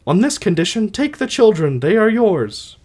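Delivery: high-pitched put-on wife's voice; Alcestis voice. Wife handing the children to her husband, high put-on voice. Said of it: "I'll try to do voices".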